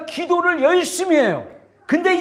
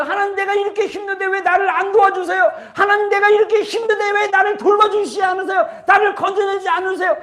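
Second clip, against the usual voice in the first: complaining believer; 6 semitones higher than usual. Retold or imitated. Resentful believer complaining to God, raised plaintive cry. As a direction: whine your grievance up at heaven. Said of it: "막 이러면서".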